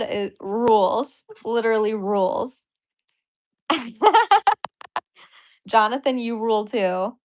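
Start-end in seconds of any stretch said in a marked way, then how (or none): 3.7-5.0 loud, high burst of laughter; shrieking laugh at the chat; high cackle. Real, not acted. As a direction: let a delighted cackle out. Reacting to donor chat.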